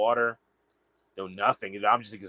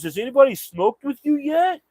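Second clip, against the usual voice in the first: much higher, louder offer; stranger's voice; 14 semitones higher, 7 dB louder than usual. Retold or imitated. Stranger invites the narrator to smoke, high loud voice. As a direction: pitch way up, friendly and loud. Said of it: "He goes".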